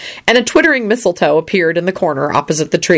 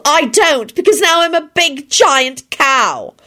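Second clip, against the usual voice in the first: high, acted wail; drawn-up tearful cry; Elizabeth, wailing; 10 semitones higher, slower than usual. A narrator's reading. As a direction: wail it in self-pity. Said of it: "Elizabeth wailed".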